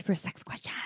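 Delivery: fast playful whisper. Acted. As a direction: whisper it like a secret. Teasing lead-in.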